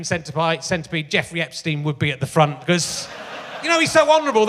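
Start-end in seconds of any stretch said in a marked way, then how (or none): 3.6-4.5 sarcastic. Acted. Sarcastic praise of Prince Andrew.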